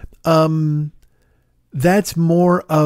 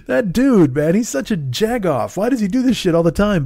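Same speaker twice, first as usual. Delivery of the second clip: exasperated friend voice; 7 semitones higher than usual. Pretend. Voicing friend's complaint about him.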